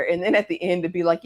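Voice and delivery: normal talking voice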